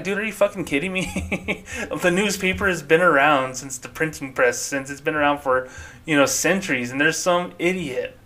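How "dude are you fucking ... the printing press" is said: higher-pitched, laughing disbelief; can't believe it, cracking up; incredulous laughing. Retold or imitated. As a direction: laugh through the words in disbelief. Incredulous reaction to a Facebook complaint.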